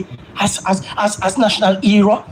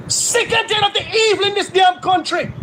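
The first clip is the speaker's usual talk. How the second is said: angry shout; 6 semitones higher than usual. Real, not acted. Angry outburst.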